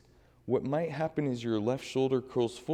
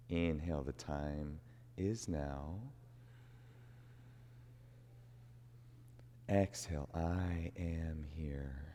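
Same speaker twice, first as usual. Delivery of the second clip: slow, soft, hushed mantra voice; guided mantra meditation; 5 semitones lower, 10 dB quieter, slower than usual. Acted. Yoga teacher guiding class through a mantra, slow and hushed. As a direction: breathe the mantra out slowly and softly.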